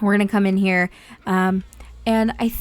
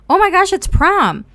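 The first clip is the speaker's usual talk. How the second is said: high, loud, thrilled; overjoyed that prom has arrived; excited squeal; 11 semitones higher, 8 dB louder than usual. Real, not acted. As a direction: burst out with joy. Notices prom event.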